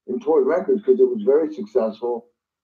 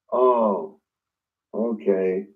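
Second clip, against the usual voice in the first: deflated; 4 semitones lower, much slower than usual. Retold or imitated. Told he's replaced.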